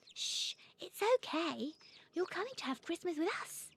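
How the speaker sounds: put-on soothing little girl. A narrator's reading. Harriet soothes Robin in box.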